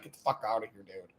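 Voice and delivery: dismissive quiet. Retold or imitated. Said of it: "was like".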